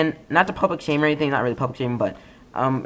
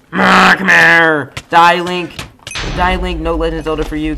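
mock battle cry; 4 semitones higher, 8 dB louder than usual. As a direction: roar at the enemy, hamming it up. Chasing and killing an opponent.

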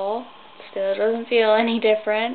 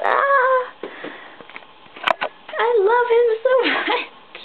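excited squeal; 14 semitones higher, slower than usual. Real, not acted. Gushing about boyfriend.

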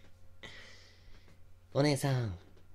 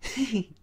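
creepy giggle; 11 semitones higher than usual. Acted. Amused stalker.

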